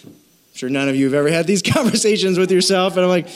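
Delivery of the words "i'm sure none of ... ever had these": ordinary talk, nothing marked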